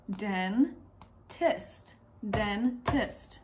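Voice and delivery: "dentist" slowly as two separate beats, den, tist; split into syllables. Shown as a demonstration. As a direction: break "dentist" apart, pausing between den and tist. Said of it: "Break the word dentist into its syllables".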